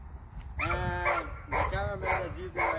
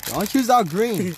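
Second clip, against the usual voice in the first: louder, urgent call out; loud warning; 3 semitones higher, 12 dB louder than usual. Real, not acted. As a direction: call it out to stop him. Warning friend picking candies.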